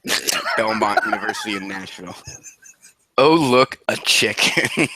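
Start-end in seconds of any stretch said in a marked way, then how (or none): 3.2-4.9 slow drawn-out repeat. Acted. Repeats title more slowly.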